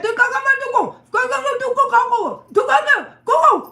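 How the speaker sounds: high, loud rapid go go go; urgent shouting. Retold or imitated. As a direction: hurry her out, shouting. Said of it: "ran up there and said".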